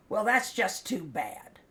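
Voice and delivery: retold angry retort. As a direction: fire back like a fed-up mother. Retold mother snapping back.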